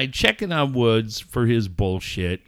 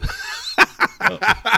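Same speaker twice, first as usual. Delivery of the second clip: real laugh; 8 semitones higher than usual. Real, not acted. Laughing at own retort.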